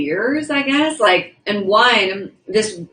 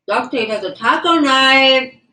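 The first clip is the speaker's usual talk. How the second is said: higher, slow, delighted read-out; excited; 6 semitones higher, 5 dB louder than usual. Real, not acted. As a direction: savour each word with glee. Reading a viewer's message.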